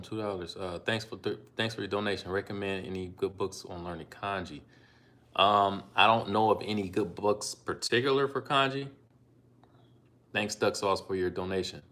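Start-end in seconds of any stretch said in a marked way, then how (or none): none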